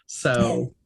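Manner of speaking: ordinary talk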